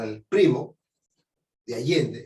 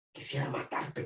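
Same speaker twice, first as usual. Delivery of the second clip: whispered, much softer murderous line; hushed threat; 9 dB quieter than usual. Retold or imitated. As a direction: breathe the threat under your breath. Furious man speaking in anger. (amenaza susurrada)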